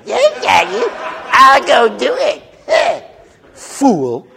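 mocking goody-goody. Aggressive firstborn mocking his cousin, squeaky laughing mimicry. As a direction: ham up the obedient cousin to ridicule him.

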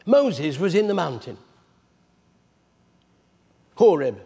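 usual speaking voice

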